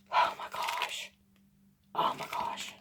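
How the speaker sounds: breathless awed whisper. Real, not acted. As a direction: whisper it in disbelief. Gasping at unboxed gift.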